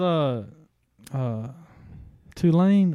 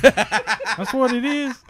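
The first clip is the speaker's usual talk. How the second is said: laughing through the words; high, slow giggling line; 8 semitones higher, 3 dB louder, much slower than usual. Real, not acted. Laughs after the joke.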